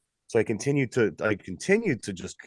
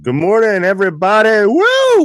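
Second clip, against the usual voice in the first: excited; 10 semitones higher, 11 dB louder than usual. Real, not acted. Show host big opening.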